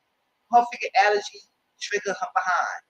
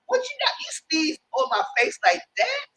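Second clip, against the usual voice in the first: mock outrage; 8 semitones higher than usual. [name] reacting to sneeze.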